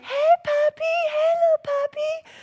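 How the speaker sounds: put-on very high cooing baby talk to a puppy. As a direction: coo up high like calling a little dog.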